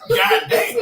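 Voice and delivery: high shocked exclamation, half laughing; mock shocked goddamn. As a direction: jump way up in pitch in mock disbelief. Retold shocked reaction.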